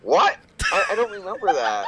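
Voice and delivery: high, startled protest; real bafflement. Real, not acted. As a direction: blurt it out, caught completely off guard. Baffled by an unexpected question.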